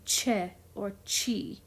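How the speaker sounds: "che or chi" said cheh, chee, slowly; CH sound starting both "che" and "chi". Shown as a demonstration. Teacher shows C before E or I, "che or chi" with CH. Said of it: "if it is an I".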